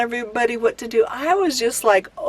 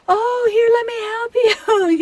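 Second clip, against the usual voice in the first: high, sweet put-on helper voice, much higher; overly helpful stranger; 12 semitones higher than usual. Imitating a stranger at Walmart offering help in a high sweet voice. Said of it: "and she goes".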